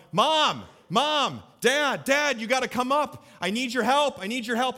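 imitating a boy calling for help; calling child. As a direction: call down the stairs like a needy four-year-old. Son calling down stairs.

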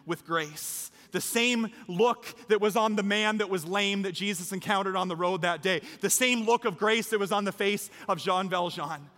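rousing, emphatic sermon climax; impassioned preaching. Real, not acted. Preacher urges congregation toward baptism, impassioned preaching. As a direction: drive the appeal home to the whole room. Preacher builds to closing appeal.

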